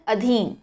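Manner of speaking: अधीन with a short first a; correct pronunciation. Shown as a demonstration. Teacher modelling अधीन with a short a for students. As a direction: say अधीन, not aadheen. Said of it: "क्या बोला जाता है".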